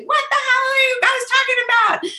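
high, near crying exasperation; exasperated cry. Retold or imitated. Confused by her coaching group, tearful exasperated cry. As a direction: wail it in tearful frustration.

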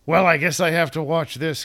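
reluctant viewer's grudging line; grudging reluctance. Retold or imitated. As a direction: put on an unwilling "fine, if I must" voice. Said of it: "my face in a ... kind of a look".

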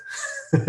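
quiet rueful laugh; soft breathy laugh. Real, not acted. Closing a tough story, soft laugh. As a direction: let out a small laugh. Laughs after hard memory.

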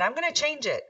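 high-pitched determined declaration; spirited, high-voiced self-quote; motivated resolve. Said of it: "I just got really, uh, motivated".

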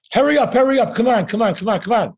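high, hurried, pushy urging; impatient rushing. Retold or imitated. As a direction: hurry the doorman along, impatient. Pushy guy rushing the doorman.

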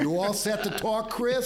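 put-on high, loud Rhode Island accent. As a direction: ham up a Rhode Island local. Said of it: "my best Rhode Island accent".

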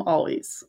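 "always" reduced to ALL-weez; soft "always" without the long A; dropped A. Shown as a demonstration. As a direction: let the end of "always" shrink to weez. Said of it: "I dropped the A".

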